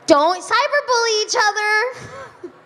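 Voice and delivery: preachy, slow, high-pitched school-assembly plea; earnest school talk. Shown as a demonstration. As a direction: lecture the teens with mock sincerity. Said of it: "I had to say this".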